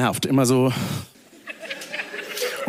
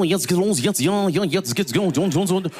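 fairground announcer; 3 semitones higher than usual. Retold or imitated. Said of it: "immer dieselbe Stimme".

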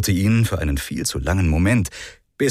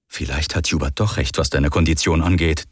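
cynical inner voice; 6 semitones lower than usual. Acted. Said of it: "dachte ein zynischer Teil von ihm".

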